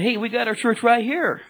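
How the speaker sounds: high, teasing quip to a passenger; a playful jab, half laughing; joking. Retold or imitated. Cab driver teasing his passenger about church, joking and high. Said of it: "I was, I was joking".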